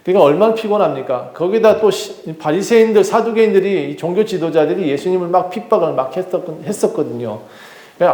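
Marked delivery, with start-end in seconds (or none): none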